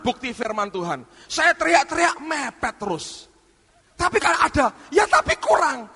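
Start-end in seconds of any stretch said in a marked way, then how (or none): 3.9-5.9 high, whining grumble; grumbling. Retold or imitated. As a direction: whine that it isn't enough. Imitated grumbling. (gerutuan dengan nada tinggi)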